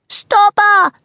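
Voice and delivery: high, loud hunter's shout; full-voiced cry in pursuit; urgent shout. A narrator's reading. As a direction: yell the order to the others. Hunter shouts during the chase.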